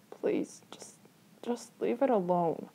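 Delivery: halting, understated plea; fear and weariness. A narrator's reading. Little brother begging to be left alone, fearful and weary. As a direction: plead wearily, trailing between words. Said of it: "fear and weariness in his voice".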